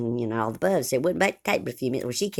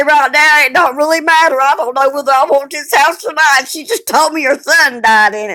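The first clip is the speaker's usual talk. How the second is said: higher and louder, worked up; agitated outburst; 10 semitones higher, 8 dB louder than usual. Retold or imitated. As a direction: blurt it out in a fluster. Blurting out her panic.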